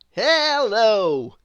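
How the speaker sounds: confident booming storybook hero; Mister Impossible voice. A narrator's reading. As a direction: boom it out like a proud show-off. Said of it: "said Mister Impossible".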